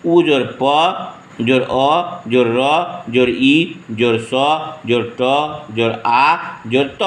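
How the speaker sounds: slow, letter by letter, जोर between each sound; spelled out slowly. Shown as a demonstration. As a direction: dictate every letter apart for pupils. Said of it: "इसको इस प्रकार से लिखेंगे".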